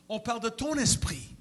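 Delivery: normal talking voice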